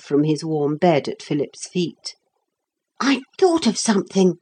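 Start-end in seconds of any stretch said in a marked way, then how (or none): 3.0-4.4 high, contained excitement; excited. A narrator's reading. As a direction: share a sudden idea, voice raised in pitch. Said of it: "in a low, excited voice".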